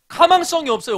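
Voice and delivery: 가망성이 punched high and loud; emphatic. Acted. Preacher repeating to students, no hope, pitched high and loud. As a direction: hit 가망성이 hard, pitch up, then fall. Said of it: "preacher repeats".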